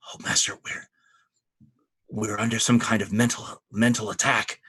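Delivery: stage whisper into his ear; urgent whisper. Acted. Shield brother warns his master of mental attack, whispering. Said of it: "leans over and whispers in his ear".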